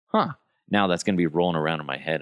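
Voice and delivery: normal talking voice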